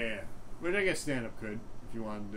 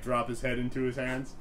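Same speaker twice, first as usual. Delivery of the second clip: ordinary delivery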